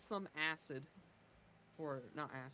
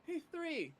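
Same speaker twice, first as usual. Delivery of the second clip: high, raised in-character yell; calling out; 12 semitones higher, 4 dB louder than usual. Acted. Rakona shouting for attention, calling out. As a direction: call up to someone out of sight. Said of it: "will yell out".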